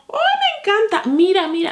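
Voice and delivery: high, excited squeal of delight; thrilled squeal. Real, not acted. Unboxing a lipstick she loves. (chillido de emoción, muy agudo)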